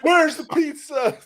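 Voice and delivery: high, slow hammed-up yell; joking about the gunman, yelling for pizza; mock shout. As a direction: holler it like a joke demand.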